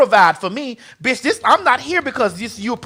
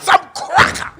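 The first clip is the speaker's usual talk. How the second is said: CRACKER hissed high and voiceless; emphatic repeat on request; 9 semitones higher than usual. Real, not acted. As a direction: repeat it with CRACKER drawn into a harsh whisper. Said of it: "A what?".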